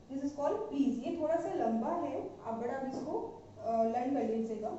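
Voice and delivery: usual speaking voice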